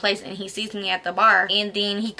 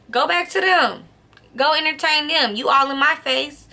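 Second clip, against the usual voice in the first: indignant rant, pitch climbing higher; retold angry telling-off; 4 semitones higher than usual. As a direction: replay telling him off, getting higher as it goes. Acts out telling him off.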